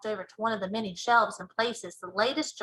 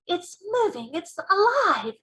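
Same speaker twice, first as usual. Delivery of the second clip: high, slow amazed cry; excited cry; 8 semitones higher, 5 dB louder, slower than usual. Acted. Sophie seeing the dream move in the jar, high amazed cry. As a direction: gasp it out in wonder. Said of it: "Sophie cried".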